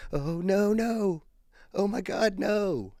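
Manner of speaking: high, stretched-out wail of "no"; dismayed cry. Retold or imitated. Fiancée seeing a groundhog hit on the road, high dismayed cry.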